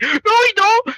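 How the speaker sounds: very high, squealed denial; high-pitched cartoonish whine; indignant denial. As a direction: squeak out a mock protest. Retold protest to accusers.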